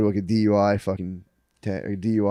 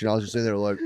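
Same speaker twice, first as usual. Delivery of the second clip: ordinary talk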